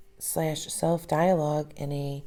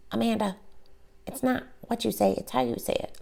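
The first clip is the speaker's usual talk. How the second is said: higher, put-on nagging mom voice; mom impersonation; 6 semitones higher, faster than usual. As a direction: correct her like a stern mom lecturing her kid. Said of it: "That's my mom impersonation".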